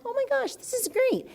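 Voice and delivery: a reader thrilled by kind blog comments, squealing. Retold or imitated.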